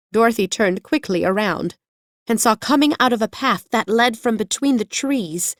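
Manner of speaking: plain reading voice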